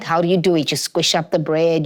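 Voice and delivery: ordinary talk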